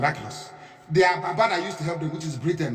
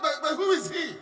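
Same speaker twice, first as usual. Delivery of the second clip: mock puzzled question; 8 semitones higher than usual. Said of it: "He must".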